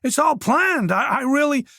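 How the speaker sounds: put-on a cornered young man begging; Oliver pleading. A narrator's reading.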